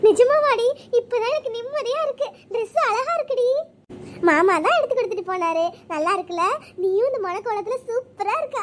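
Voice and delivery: normal talking voice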